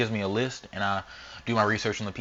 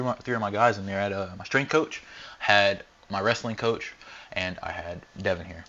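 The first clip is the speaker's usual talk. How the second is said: usual speaking voice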